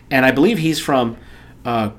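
ordinary talk, nothing marked

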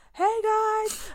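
high-pitched sing-out greeting; greeter's call. Retold or imitated. Park worker greeting guests at the gate, high-pitched call. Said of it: "he was like".

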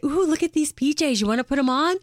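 high, sing-song coaxing to tempt her; sweet coaxing. Retold or imitated. Daughter coaxing her mother with new pajamas, high and sweet. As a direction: coax her like a child with a treat.

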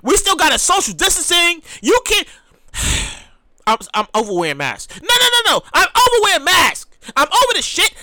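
ordinary talk

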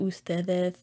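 "ustedes" with lisped th for the s sounds; Castilian lisp. Shown as a demonstration. Imitating a visiting goddaughter from Spain, Castilian lisp. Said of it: "the lisp that's in Spain".

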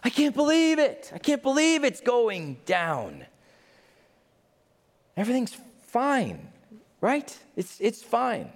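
high, slow, wailing lament; hammed-up melodramatic woe; woeful kings. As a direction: wring your hands and wail. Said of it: "stand off and say".

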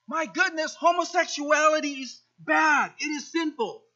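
hammed-up outrage at one sin; mock outrage. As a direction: play the self-righteous churchgoer. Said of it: "selective indignance".

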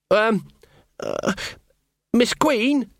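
put-on timid young lad knocking at a door; nervous boy. Sean calling through the door to Magrat, nervous and high.

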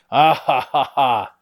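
short gloating bad-guy laugh; bully laughing and jumping with the mask; villain laugh. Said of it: "He's laughing and jumping".

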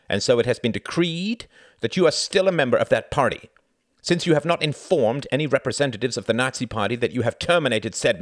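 high, loud, harsh verdict read; loud official reading. Acted. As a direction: bark out the charges. Jorgen reads the charges.

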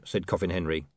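ordinary delivery, nothing marked

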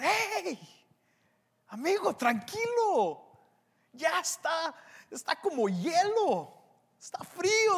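high-pitched, carefree cajoling; laid-back reassuring tomb. Acted. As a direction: soothe a worried friend in a high, exaggerated singsong. Tomb cheerily reassuring the devil.

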